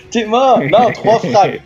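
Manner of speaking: high, drawn-out protest; playful exclamation. Real, not acted. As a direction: stretch out the correction, teasing. Teasing correction after the match.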